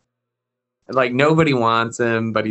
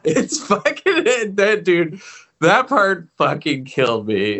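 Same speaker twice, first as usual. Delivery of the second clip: ordinary talk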